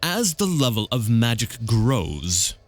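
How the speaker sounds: very slow, deep, savored delivery; Brakos. Acted. Dragon's representative lecturing on magic, slow and ponderous. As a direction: relish each vowel and consonant, taking your time. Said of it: "Brakos speaks slowly with a deep, melodious German accent".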